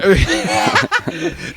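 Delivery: loud burst of laughter; laughing. Real, not acted. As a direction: laugh hard and high. Laughing at the story.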